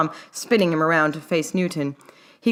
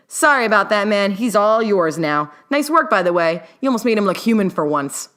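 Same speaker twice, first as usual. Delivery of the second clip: sarcastic; 5 semitones higher, 5 dB louder than usual. Acted. Newt sarcastic to Wu.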